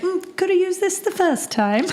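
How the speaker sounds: dry joke as Claire; wry quip. Retold or imitated. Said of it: "and she's like".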